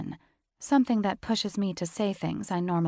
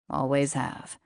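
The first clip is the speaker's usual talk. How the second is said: lower, husky-free steady man's voice; low male voice; 4 semitones lower than usual. A narrator's reading. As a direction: voice him low and cool.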